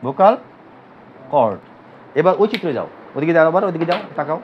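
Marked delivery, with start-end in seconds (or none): 0.1-1.6 vocal, pause, then cord, split into two slow parts; teacher drilling the class on vocal cord, split slowly into two parts; slowed for students to repeat. Shown as a demonstration. Say vocal, wait a beat, then cord. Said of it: "এটা কি?".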